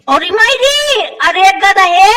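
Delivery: normal talking voice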